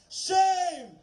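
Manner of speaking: loud high cry of shame; shouting. Real, not acted. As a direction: hurl the word at them. Protest speech denouncing rulers.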